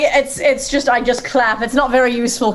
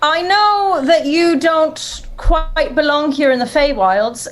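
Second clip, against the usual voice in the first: high, polite introduction; courteous hero pitched high; polite adventurer; 7 semitones higher, slower than usual. Acted. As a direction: address the dragon in a higher, careful voice. Player pleads as Lilia with dragon.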